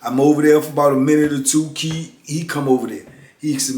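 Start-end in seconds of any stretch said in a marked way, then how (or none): none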